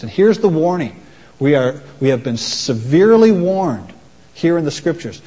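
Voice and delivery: usual speaking voice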